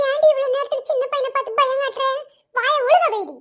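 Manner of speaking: a mother snapping at her daughter; scolding mother. Acted. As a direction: tell her off sharply for scaring the boy. (மகளை கண்டிக்கும் அம்மா)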